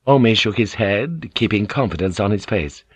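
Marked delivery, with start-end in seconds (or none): none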